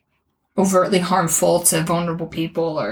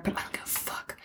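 whisper; 15 dB quieter than usual. Real, not acted. Defiant aside about right-wing author.

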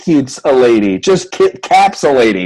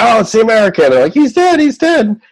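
mock excited rebels; 9 semitones higher than usual. Rebels find Solo lying in state.

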